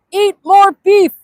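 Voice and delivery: loud, high, drawn-out command; mock slogan shout. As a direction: holler each word like a slogan.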